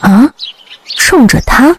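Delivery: mildly startled, questioning rise; puzzled double-take; baffled. A narrator's reading. Companion learns they are coming for him, baffled. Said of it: "满脑子疑惑".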